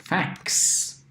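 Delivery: "fax" said slowly; slow demo word. Shown as a demonstration. Teacher models the word fax slowly. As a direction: stretch "fax" for learners. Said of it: "we say it".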